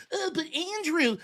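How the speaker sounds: a mock pleading voice aping the moderator; mocking whiny imitation. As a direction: mimic her pleading to Andrew, slow and whiny. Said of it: "she's out there running today like".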